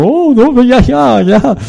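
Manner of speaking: overwhelmed husband. Acted. Pastor voicing a husband after hours of listening, high pleading to stop. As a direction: beg for it to stop, swamped.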